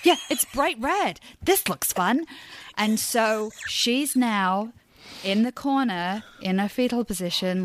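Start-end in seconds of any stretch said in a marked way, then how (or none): none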